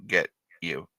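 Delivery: normal talking voice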